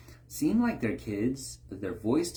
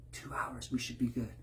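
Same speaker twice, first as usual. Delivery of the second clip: hushed whisper; 6 dB quieter than usual. Acted. Plotting soldiers whisper the plan in hiding, hushed. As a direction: whisper so the sleeping guys don't wake.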